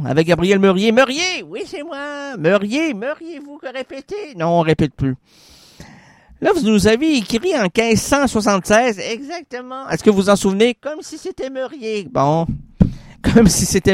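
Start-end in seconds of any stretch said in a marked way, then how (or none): 0.9-4.3 spirit voice. Acted. Spirit answers, high and soft. 10.7-12.1 ghost voice. Acted. Spirit line, high and soft.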